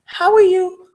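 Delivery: high squawky parrot mimicry; high parrot-like greeting. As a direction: chirp it like a pet parrot. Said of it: "And it will say".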